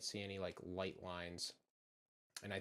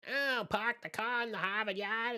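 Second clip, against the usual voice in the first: loud, high Boston heckle with R's dropped; mocking Boston accent; 14 semitones higher, 8 dB louder than usual. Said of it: "if I lose an R".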